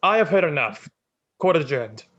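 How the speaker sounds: loud judge's pronouncement; commanding finality. Acted. Magistrate cutting off the widow and closing court, loud command. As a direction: shut the whole hearing down loudly. Oyoto ends the hearing.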